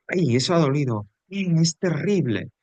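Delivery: teacher hamming up a pained "ay"; mock pain. Shown as a demonstration. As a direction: act out a twinge of pain, slowing on the second.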